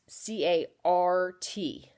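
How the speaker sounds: C A R T spelled slowly, letter by letter. Shown as a demonstration. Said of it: "we always add it after the vowel".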